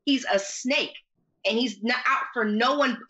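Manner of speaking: ordinary talk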